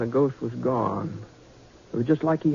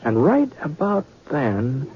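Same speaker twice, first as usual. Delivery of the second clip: slow suspense; 4 semitones higher, slower than usual. A narrator's reading. Narrator builds ghost suspense.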